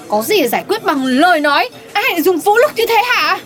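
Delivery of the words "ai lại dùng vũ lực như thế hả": ordinary delivery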